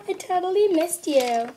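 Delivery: normal talking voice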